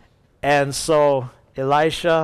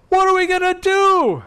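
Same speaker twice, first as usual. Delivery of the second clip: hammed-up high, loud wail; exaggerated frantic servant, voice shooting up; panicked cry; 5 dB louder than usual.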